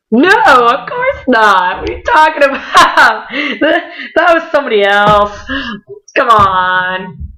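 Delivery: drawn-out, high, giggly protest; mock denial. Friends kidding Josh that he never saw them, giggly mock denial. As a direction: deny it playfully, breaking into laughter.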